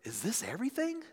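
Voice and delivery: soft, high, rising delight; hushed wonder, lifting at the end. Acted. Child on birthday after opening presents, delighted. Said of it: "the other expresses delight".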